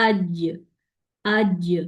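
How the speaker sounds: "اج" bouncing off into a short voiced echo; voiced bounce ending "اج"; qalqalah on jeem. Shown as a demonstration. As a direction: hit the j of "اج" and spring straight back. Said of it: "پلٹنا ہے تو کلکلا ہو جائے گا".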